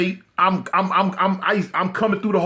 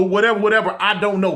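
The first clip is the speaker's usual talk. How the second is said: ordinary talk, nothing marked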